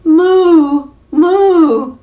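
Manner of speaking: high, loud frightened mooing; cow crying moo. Acted. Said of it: "Gerda cried".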